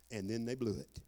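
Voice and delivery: ordinary talk, nothing marked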